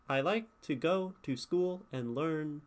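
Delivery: "like", "go", "school", "learn" stressed in a steady beat; iambic stress. Shown as a demonstration. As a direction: scan it weak-strong, landing on "like", "go", "school", "learn". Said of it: "if I were to speak".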